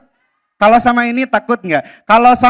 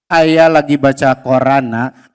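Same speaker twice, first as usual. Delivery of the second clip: lower, calm fatherly reply; 10 semitones lower than usual. Acted. Father answering his son Abdi, low and calm. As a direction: answer as a gentle dad.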